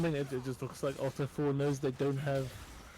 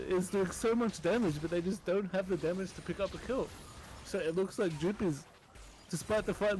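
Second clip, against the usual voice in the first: hyped play-by-play; 4 semitones higher than usual. Acted. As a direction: call the teamfight live, urgent. Fast live esports play-by-play.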